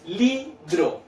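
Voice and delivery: "Libro" broken into two beats, li and bro; split into syllables. Shown as a demonstration. Teacher separates the word for children, split into syllables. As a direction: pause between li and bro in "Libro". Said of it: "la separo en dos sílabas".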